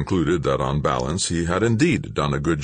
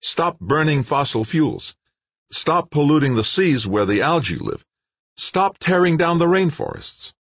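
with emotion; 12 semitones higher, 4 dB louder than usual. A narrator's reading. Scientist pressing an interviewer to act, impassioned. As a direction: hammer each stop home. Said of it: "replied with some emotion".

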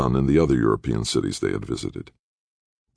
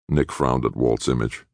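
ordinary delivery

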